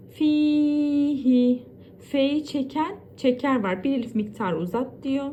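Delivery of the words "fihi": ordinary delivery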